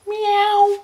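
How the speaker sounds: very high, loud kitty mew; cat meow. Retold or imitated. Man playing cat on a dull day, high meow. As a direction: give one high, drawn-out mew. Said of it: "I used to go".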